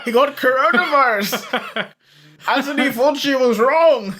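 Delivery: ghostly Santa breaking into laughter; spooky Santa voice, laughing through it; Dead Santa voice. Acted. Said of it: "impression of Dead Santa".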